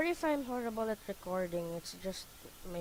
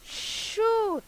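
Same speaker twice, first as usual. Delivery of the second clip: frustrated shout; 10 dB louder than usual. Real, not acted. Losing the fight.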